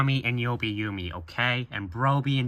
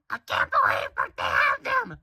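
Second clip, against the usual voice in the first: put-on thrilled falsetto girl; very high squeaky girl voice; Ami. Ami finding the toy she wants, squeaky excitement. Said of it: "Ami said".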